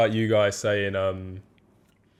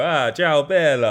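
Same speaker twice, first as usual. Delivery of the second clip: hammed-up Italian greeting, a bit louder; mock Italian; 5 semitones higher, 7 dB louder, slower than usual. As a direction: throw it out like a tourist faking Italian. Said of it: "I don't fucking walk into ... go".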